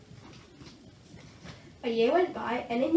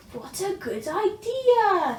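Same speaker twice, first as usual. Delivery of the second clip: slow, higher, louder dragon; eager young dragon; Zog pleased; 8 semitones higher, 6 dB louder, slower than usual. A narrator's reading. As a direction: stretch it out happily. Zog answers brightly.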